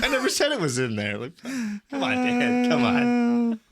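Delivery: high-pitched mock-indignant pleading; exasperated protest. Retold protest to the friend.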